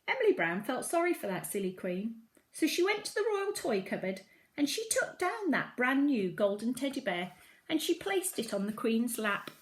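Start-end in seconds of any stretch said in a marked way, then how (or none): none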